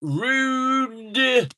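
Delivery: high squeaky mock-offended "Rude"; high mock-indignant squeal. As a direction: squeal it in fake outrage.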